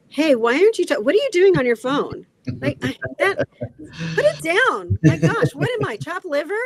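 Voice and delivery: high, loud accusing snap; mock-confront the partner, hammed up. Shown as a demonstration. Jealous partner confronting over the phone, mock accusing. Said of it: "that kind of a thing is gonna go a long way versus".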